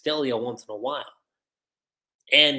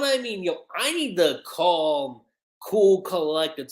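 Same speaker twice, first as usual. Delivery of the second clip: ordinary delivery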